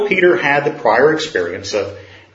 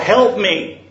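a raised call, pitched up; mock cry for help; 6 semitones higher than usual. Man hanging off the cliff cries for help, lightly acted. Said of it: "he cries out".

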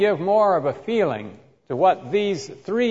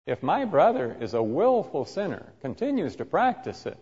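ordinary talk

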